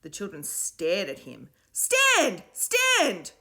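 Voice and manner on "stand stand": high, loud chanted order; cried out. A narrator's reading. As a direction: echo the command forcefully. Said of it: "cried Davina and Stanley".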